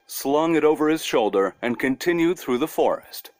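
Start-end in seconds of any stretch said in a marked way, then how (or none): none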